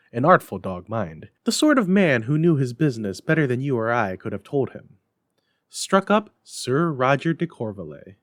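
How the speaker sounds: plain reading voice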